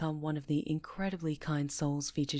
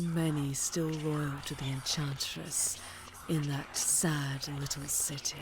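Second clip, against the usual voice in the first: sinister sorcerer; slower than usual. Acted. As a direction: purr every threat like a gloating dark lord. Villain addresses captive witch.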